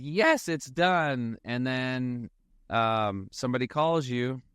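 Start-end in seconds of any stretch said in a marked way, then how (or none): 0.0-1.4 relieved triumph. Retold or imitated. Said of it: "and you're like".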